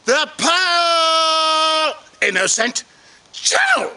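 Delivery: very slow announcer delivery with long pauses; drawn-out theatrical farewell, phrase by phrase; hammed-up show host sign-off. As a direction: stretch the words out grandly.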